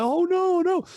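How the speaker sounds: alarmed oh no. Retold or imitated. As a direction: realise you've given yourselves away. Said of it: "I was like".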